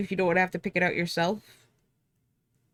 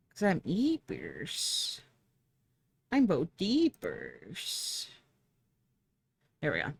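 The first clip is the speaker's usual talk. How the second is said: very slow, drowsy cutesy words; sleepy baby talk; 2 semitones lower, 5 dB quieter, much slower than usual. Pretend. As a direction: drag it out like you're half asleep.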